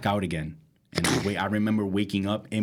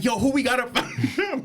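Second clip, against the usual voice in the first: put-on drunk fight challenge; 12 semitones higher than usual. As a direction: pick a fight, way too drunk.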